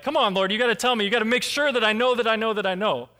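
urgent come on Lord; pleading. Retold or imitated.

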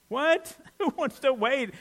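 high, loud incredulous what; hammed-up disbelief at being told to wait; sharp high mock protest; mock outrage.

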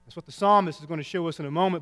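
usual speaking voice

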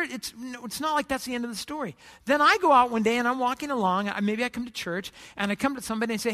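usual speaking voice

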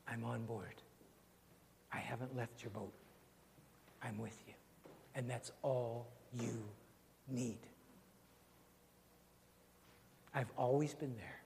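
soft, low, tender promise; hushed reassurance to those in storms; voice of the Lord. Acted. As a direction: speak it gently as God's comfort. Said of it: "hear the word of the Lord".

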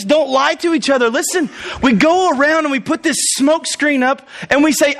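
normal talking voice

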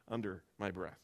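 plain reading voice

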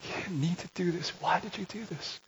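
high, soft pleading father; tender father. Retold or imitated. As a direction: plead gently as a grieving father. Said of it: "with a father's heart".

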